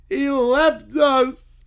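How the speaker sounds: loud, slow, tearful cry; acted wail. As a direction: wail like an abandoned kid. Kid abandoned in maze.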